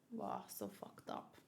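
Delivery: slow breathy whisper of disbelief; stunned whisper. Real, not acted. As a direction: whisper it, horrified. Reacts to the scene.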